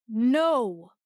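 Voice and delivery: loud cry; desperate scream. A narrator's reading. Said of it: "I screamed".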